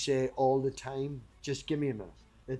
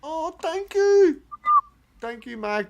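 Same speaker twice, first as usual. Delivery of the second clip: put-on thanks; 14 semitones higher, 7 dB louder, slower than usual. Reacting to chat compliment.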